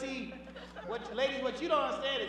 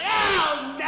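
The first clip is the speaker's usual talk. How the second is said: loud, higher drawn-out groaning yell; dismayed yell; 3 semitones higher, 9 dB louder than usual. Acted. Man jolted by talk of mama's underwear, dismayed yell. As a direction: wail it in mock dismay. Acted outburst over mother's panties.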